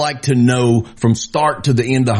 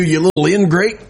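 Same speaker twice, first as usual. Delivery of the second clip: ordinary talk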